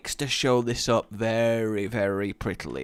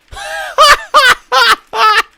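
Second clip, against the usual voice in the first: loud high laugh; 15 dB louder than usual. Real, not acted. Big laugh.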